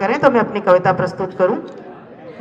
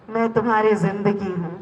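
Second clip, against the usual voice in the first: hushed reveal; 9 dB quieter than usual. Acted. Life revealing itself to the desperate listener, hushed. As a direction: reveal it quietly, almost to yourself. Poem's reveal, said low first.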